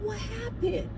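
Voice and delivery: high, hushed concern; concerned questioning. Retold or imitated. Manager asking crying resident what is wrong, concerned.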